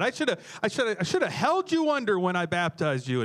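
a hammed-up joking threat; mock exasperated pastor. Pastor joking to Pete about holding him under, mock exasperation. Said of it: "I said".

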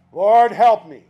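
loud, punchy three-word prayer; forceful short appeal; emphatic prayer. Shown as a demonstration. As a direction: call it out firmly, falling at the end. Preacher demonstrates his three-word prayer.

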